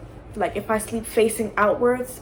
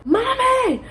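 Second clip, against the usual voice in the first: high-pitched mock cry for mom; retold scream; 14 semitones higher, much slower than usual. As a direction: imitate a child screaming for help. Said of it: "I scream for my mom".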